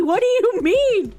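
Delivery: high, stretched, incredulous question; baffled protest. Real, not acted. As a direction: ask it in whiny disbelief. First baffled take.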